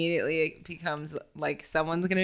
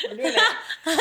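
high, giggly cheer; giggling glee; laughing; 11 semitones higher, slower than usual. Real, not acted. Laughing together.

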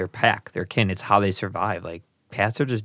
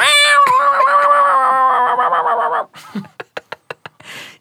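loud high-pitched burst of laughter; 9 dB louder than usual. Real, not acted. Big laugh at friend.

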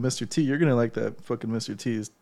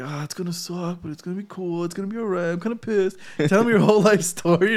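mimicking hippie chick; 6 semitones higher than usual. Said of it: "'Cause she's super hippie".

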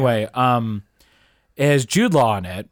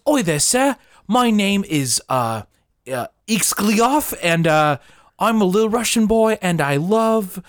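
high-pitched mock British accent; Russian boy played as a plucky British urchin; 7 semitones higher than usual. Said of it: "Well, he's British".

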